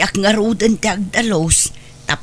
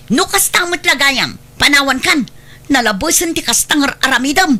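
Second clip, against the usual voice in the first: angry threat; 6 semitones higher than usual. Acted. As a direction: throw the ultimatum at him. Wife threatens to leave. (nakapungtot nga pangta)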